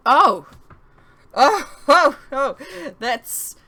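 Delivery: loud, high, laughing delight; laughing loud high outburst; giddy laughing. Acted. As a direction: giggle through the words. Lolitha giggling after rescue.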